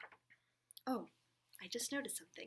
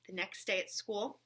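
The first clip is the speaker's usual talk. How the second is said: lively read-aloud with character voices; reading to children; 3 semitones higher, 10 dB louder than usual. Acted. Reading a picture book aloud to children, animated. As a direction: read it brightly to the little ones.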